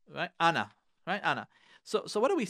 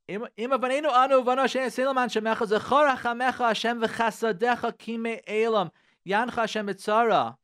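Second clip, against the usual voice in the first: rapid rhythmic Hebrew recitation; fast prayer davening; 4 semitones higher, 4 dB louder, faster than usual. Acted. Rabbi reading Tachanun to listeners, fast davening chant. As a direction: rattle off the prayer like at morning services.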